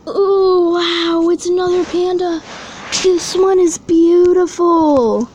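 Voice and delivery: high, loud gushing; awed delight. Real, not acted. Collector finding another panda, loud awed delight.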